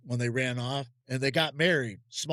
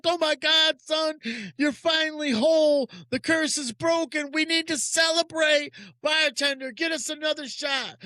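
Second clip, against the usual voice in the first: much higher, thrilled dad celebrating; overjoyed dad; 14 semitones higher, 4 dB louder than usual. Retold or imitated. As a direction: burst out with joy like the curse just broke. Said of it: "He's so elated. He's over the moon".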